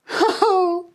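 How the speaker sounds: sharp high gasp then a startled oh; surprised gasp. A narrator's reading. Old lady wakes to find herself back in her bottle, gasping. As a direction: gasp in shock at what you see.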